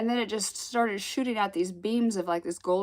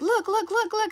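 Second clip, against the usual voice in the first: excited niece; 13 semitones higher, 3 dB louder than usual. Retold or imitated. Niece urging her to look.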